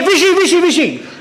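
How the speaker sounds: quick repeated call, retold lightly. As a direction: imitate hailing someone passing by. Retold hailing of cricketer on scooter.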